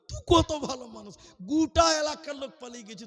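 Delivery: high, loud gushing praise; mock-grateful outburst to the only listener; effusive praise. Preacher praises his lone listener.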